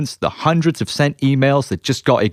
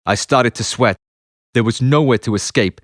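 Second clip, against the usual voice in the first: usual speaking voice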